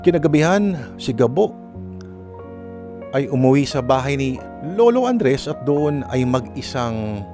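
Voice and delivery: ordinary talk, nothing marked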